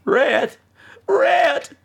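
very high, slow, wailing mock cry; hammed-up Scarlett. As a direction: wail like a swooning southern belle. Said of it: "Gone with the Wind".

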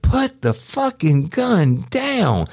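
slow, high, strained police command; drawn-out command. Retold or imitated. Cop retelling ordering the woman to put the gun down. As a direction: stretch each word out tensely. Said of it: "I'm like".

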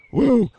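loud high whoop; loud celebratory woo. Real, not acted. Radio host cheering on air.

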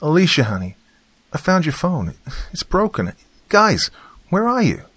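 high-pitched, worried woman calling out; distressed. A narrator's reading. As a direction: call for missing family, rising in distress. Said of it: "Olivia's voice sounded distressed".

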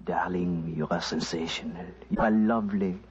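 low, suave put-on French accent; mock French accent. Announcer imagining himself wooing Casbah beauties, mock French accent. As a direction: play the French seducer, pitched low. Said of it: "whispering in my fine French accent".

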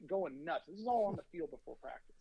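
normal talking voice

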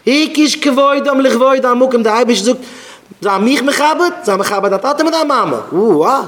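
loud, high-pitched indignant preaching; impassioned outrage. Acted. Defending his parents' honour to listeners, loud and indignant.

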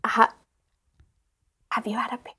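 very soft, secretive question; hushed. Acted. Friend asking something personal in confidence, hushed. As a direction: drop to a near whisper, then break off. Private question while July covers ears.

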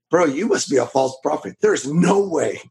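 usual speaking voice

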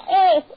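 pained cry. Acted. Wife cries out when struck.

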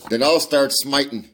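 loud, high furious retort; furious exclamation. A narrator's reading. Enraged farmer answers the threat, furious shout. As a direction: fire it back ready to fight. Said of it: "exclaimed Ferrier furiously".

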